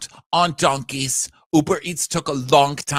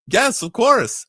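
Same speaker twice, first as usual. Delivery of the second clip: cheerful yes; 12 semitones higher, 7 dB louder, slower than usual. Retold or imitated. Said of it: "she goes".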